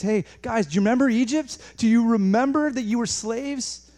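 high, loud, impassioned appeal; God urging remembrance. Acted. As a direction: plead with them to remember, stretching the last question. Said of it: "He comes in and says".

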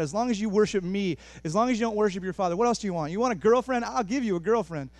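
high, loud, insistent salesman of temptations; urgent, hyped-up tempter's pitch; tempting devil. Acted. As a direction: pitch climbing as you push the offer hard. Preacher acts out devil's escalating temptations.

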